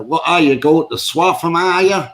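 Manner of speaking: a hint of a Norfolk local asking; Norfolk accent. Shown as a demonstration. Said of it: "that's my Norfolk accent".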